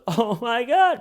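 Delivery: high, loud mock shock; mock shocked gasp. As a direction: squeal it like a shocked wife. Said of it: "She goes".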